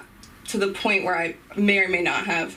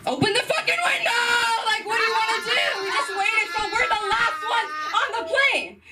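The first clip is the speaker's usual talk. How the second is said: mock furious shout; 13 semitones higher than usual. Comic telling off her mother, shrill mock-yell. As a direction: rant like the fed-up kid.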